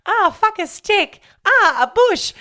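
high, loud squeal of pain, a bit slow; imitating Abigail stumbling into a rose bush, high squeal; mock Abigail yelp. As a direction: yelp like she walked into thorns.

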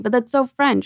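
ordinary delivery

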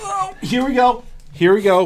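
high excited shout. Real, not acted. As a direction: cheer it out high and loud. Excited reaction to wipes.

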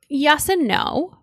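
ordinary delivery

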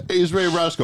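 high, loosely put-on caller voice; mock phone caller. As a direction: tease with a high-pitched mock phone greeting. Joking re-enactment of the phone call.

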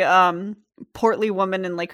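normal talking voice

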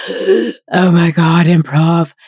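clutch your pearls in fake panic; hammed-up dread at the word improv; panicked gasp.

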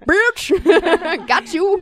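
high, loud, laughing gotcha; spring the trap with a laugh; gleeful gotcha. Pretend. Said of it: "and he's like".

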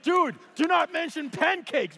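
high, mock-alarmed objection; exasperated protest. Nervous jumper objecting to the instructor's pep talk, exasperated. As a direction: protest it in comic disbelief. Retold panicked reply to instructor.

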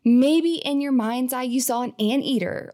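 ordinary talk, nothing marked

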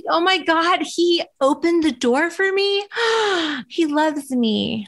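gushing, breathless starstruck voice with a gasp; hammed-up dreamy awe; mock swoon. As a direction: play the lovestruck girl swept off her feet. Mock swooning over basic courtesy.

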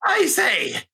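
high, hammed-up posh English; mock a stiff posh English gent; posh English accent. Speaker mocking his own posh English accent.

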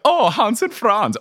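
slow, high cheery German accent; happy German. Shown as a demonstration. Demoing the happy German accent type, slow and high. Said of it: "happy like".

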